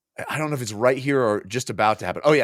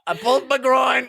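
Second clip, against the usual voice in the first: high, hammed-up cry of agony; mock pained shout; 14 semitones higher, 4 dB louder than usual. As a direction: wail it like a comically injured hero. Hero howling over pulled groin.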